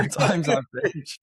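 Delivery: real amused laugh on the words. Real, not acted. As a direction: laugh as you repeat it. Repeats the phrase, then laughs.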